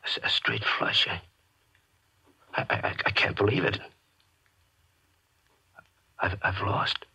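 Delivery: broken, stuttering disbelief; losing gambler stammers in dread; stammering dread. Acted. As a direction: falter as the loss sinks in. Stammering after losing the bet.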